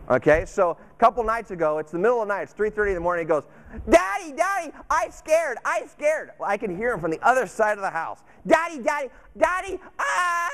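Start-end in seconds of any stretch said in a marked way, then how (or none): 3.9-6.3 high mock child's wail for daddy; scared little boy. Son calling dad at night, imitated scared child voice. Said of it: "he's going through his scared phase". 8.4-10.5 high, imitated toddler wail; crying little boy. Son crying for dad across the house, imitated wail. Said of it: "And he's crying".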